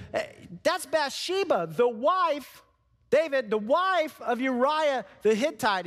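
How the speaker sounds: a nervous servant breaking news to the king; lightly voiced timid servant; servant reporting.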